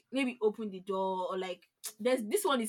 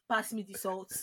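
rude demand; 6 dB quieter than usual. Retold or imitated. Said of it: "some people just use their big head".

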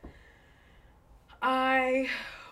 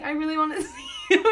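mock needy plea; 9 semitones higher, 9 dB louder, faster than usual. Mock pleading text to boyfriend.

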